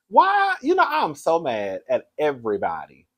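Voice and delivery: ordinary talk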